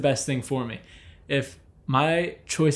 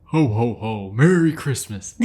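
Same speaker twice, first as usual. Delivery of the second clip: lightly done jolly Santa, a bit lower; a hint of Santa's ho ho ho; playful mock Santa greeting; 4 semitones lower, 4 dB louder than usual. Christmas sign-off, Santa laugh.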